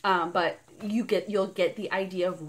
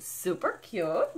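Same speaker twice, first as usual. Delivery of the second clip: ordinary delivery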